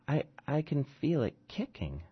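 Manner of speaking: high, soft, dreamy woman's voice; a faint, drugged plea; dazed pregnant woman. Acted. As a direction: murmur it, drifting under the drugs. Drugged mother in labor.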